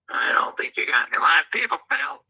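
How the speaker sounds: soft whispered aside; a hushed whisper. Shown as a demonstration. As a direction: whisper it so no one hears. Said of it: "whisper take".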